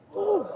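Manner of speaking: high, tense exhale; nervous whew. Real, not acted. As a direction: blow out nervous air. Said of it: "I'm shaking".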